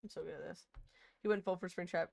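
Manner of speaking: normal talking voice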